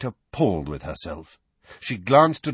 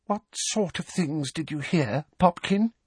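breathy; 9 semitones higher than usual. A narrator's reading. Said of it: "breathed Aunt Petunia".

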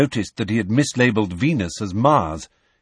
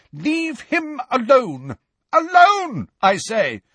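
Professor McGonagall; 13 semitones higher, slower than usual. A narrator's reading. Teacher ordering attackers off her colleague, indignant cry. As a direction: order them off, indignant and high. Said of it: "said Professor McGonagall's voice".

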